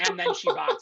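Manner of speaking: high, sing-song catchphrase. Shown as a demonstration. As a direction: pitch it way up. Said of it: "I guess that's my".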